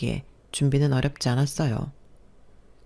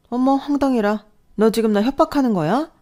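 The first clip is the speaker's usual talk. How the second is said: much higher, gasping indignation; shocked outrage; 8 semitones higher, 5 dB louder than usual. Acted. Mother-in-law shocked by talk of divorce, indignant. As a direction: clutch your pearls in disbelief.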